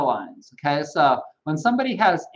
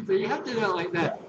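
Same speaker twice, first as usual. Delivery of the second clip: ordinary delivery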